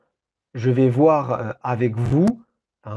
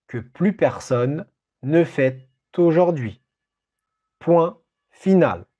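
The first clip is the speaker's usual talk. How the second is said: dictating. Shown as a demonstration. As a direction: read it out for a dictation. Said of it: "Je répète cette phrase, cette longue phrase".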